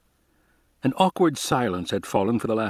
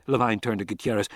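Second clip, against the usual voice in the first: plain reading voice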